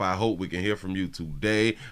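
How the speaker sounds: usual speaking voice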